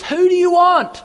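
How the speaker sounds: loud demand. Retold or imitated. Pilate calls out to the crowd in a high, loud demand. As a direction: throw the question out to the mob. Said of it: "Pilate asks".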